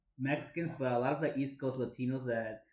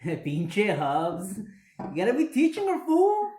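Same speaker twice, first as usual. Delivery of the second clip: rising into a shouted fool; Chicano accent; 6 semitones higher, 8 dB louder, slower than usual. Pretend.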